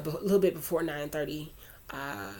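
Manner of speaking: normal talking voice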